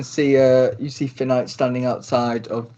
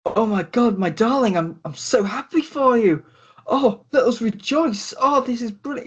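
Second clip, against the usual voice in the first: higher, gushing delight; overjoyed; 9 semitones higher than usual. Acted. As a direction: rejoice over the news in a raised voice. GM voices overjoyed Finnick.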